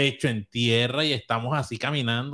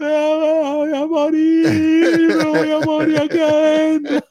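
hammed-up sob, much higher and louder, very slow; mock crying wail; 8 dB louder, much slower than usual. Kid lost in dark cave, imitating his own wailing cry. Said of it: "y yo me he puesto a llorar".

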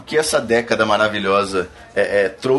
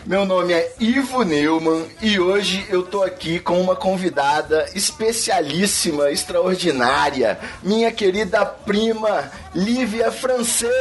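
high, slow showman intro; podcast announcer; 6 semitones higher than usual. Acted. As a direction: hype up the show like a radio host. Host announces podcast and guest.

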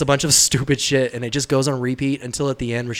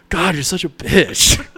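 mock exasperated; 5 semitones higher, 4 dB louder, slower than usual. Playing the heroine's dismissive friends.

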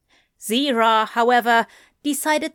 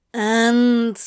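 louder, stretched-out drawl; insolent drawl; 2 semitones higher, 5 dB louder than usual. A narrator's reading. Crowley answers the angel's warning, an unimpressed drawl. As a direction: draw the word out, unimpressed. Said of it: "Crowley drawled".

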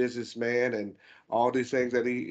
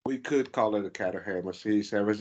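ordinary talk, nothing marked

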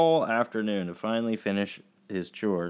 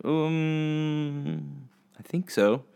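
put-on hesitant child; 7 semitones higher, much slower than usual. Son evades about his chores.

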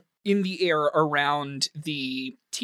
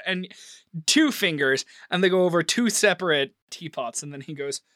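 ordinary talk

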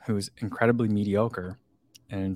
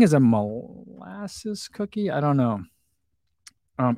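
normal talking voice

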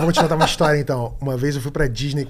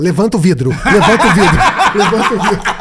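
shouting it, laughing, louder; loud panicked shout; 4 semitones higher, 8 dB louder than usual. Retold or imitated. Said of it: "ele falou".

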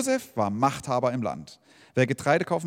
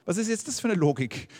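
baffled; 6 semitones higher than usual. Retold or imitated. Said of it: "würde man denken".

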